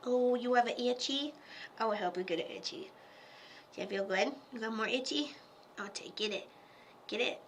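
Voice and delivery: soft, quick pet-talk voice. Real, not acted. Talking baby talk to the cat while scratching it. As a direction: coo like you're scratching a kitten.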